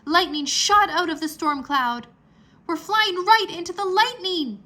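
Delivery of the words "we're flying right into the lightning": higher, louder frightened yell; alarmed yell. A narrator's reading. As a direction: yell in alarm over the storm. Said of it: "Drake yelled".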